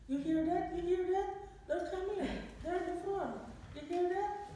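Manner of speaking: put-on insistent little girl announcing that help is near; urgent child. Little girl hearing the paramedics arrive, urgent and excited. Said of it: "dia bicara terus".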